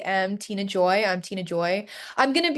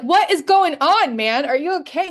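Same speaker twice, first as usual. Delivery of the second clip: higher, louder, worried outburst; alarmed; 7 semitones higher, 6 dB louder than usual. Retold or imitated. Daughter startled by her dad's midnight call, alarmed.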